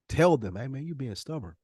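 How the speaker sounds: normal talking voice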